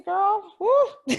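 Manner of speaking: excited whoop. Real, not acted. Host whooping to her guest about her days. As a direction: whoop it out in delight.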